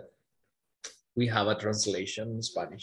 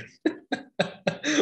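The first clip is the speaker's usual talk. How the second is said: high unvoiced burst of laughter; breathy high laugh. Real, not acted. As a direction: laugh in disbelief. Laughs at student's question.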